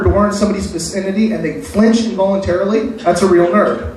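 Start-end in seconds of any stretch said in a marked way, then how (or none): none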